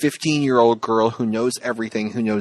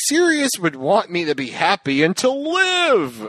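mock earnest Harry; 7 semitones higher than usual. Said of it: "Harry's like".

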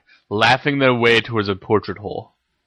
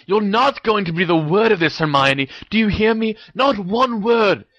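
high, agitated telling-off; angry protest; 11 semitones higher than usual. A narrator's reading. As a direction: insist frantically, voice pitched up in distress. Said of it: "he hissed".

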